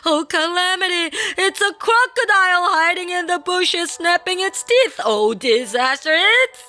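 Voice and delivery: high, frantic cries of terror; shrieking panic. A narrator's reading. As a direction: panic wildly, one disaster after another. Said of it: "he shrieked".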